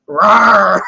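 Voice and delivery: low playful rawr; dinosaur voice. Pretend. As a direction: growl it like a T-Rex. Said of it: "Who says rawr?".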